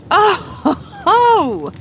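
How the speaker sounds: a gleeful "aha" laugh; knowing, delighted oh-ho-ho. Acted. Woman grasps the secret.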